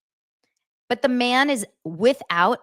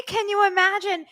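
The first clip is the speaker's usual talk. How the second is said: sing-song "Can you imagine?" way up high; catchphrase voice; 11 semitones higher than usual. Shown as a demonstration. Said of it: "because I say".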